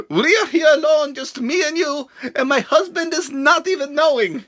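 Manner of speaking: lightly mock Russian accent; a hint of a seductive Russian lady; Lana with Russian accent. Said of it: "And she says".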